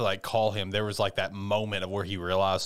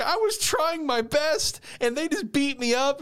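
high, drawn-out whiny wail; slow, high-pitched mock sobbing complaint; mock crying; 4 dB louder, slower than usual. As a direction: imitate a kid crying to a big brother. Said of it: "crying to him".

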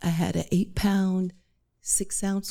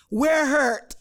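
put-on loud, high Jamaican-accented question; brisk Jamaican nurse asking; thick Jamaican accent; 7 semitones higher, 5 dB louder than usual. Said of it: "in a thick Jamaican accent".

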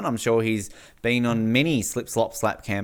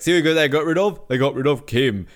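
Dicko impression; 2 semitones higher, 4 dB louder than usual. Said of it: "He's like".

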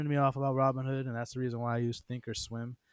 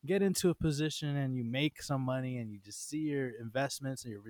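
normal talking voice

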